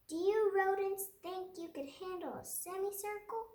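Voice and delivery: playful teacher. Acted. Teacher teasing class.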